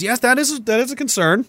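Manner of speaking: high mock-earnest agreement; sarcastic. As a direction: feign serious concern, pitch up. Sarcastic agreement after mock shock.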